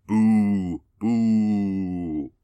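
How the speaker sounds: playful booing; mock booing. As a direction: boo them like a pantomime crowd. Booing the council.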